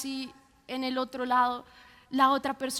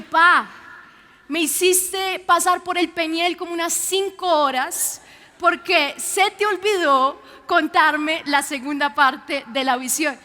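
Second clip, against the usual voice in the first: loud, playful exasperated scolding; mock scolding; 8 dB louder than usual. Daughter playfully scolding her father for the scare, loud. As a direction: tease him for the scare, loud.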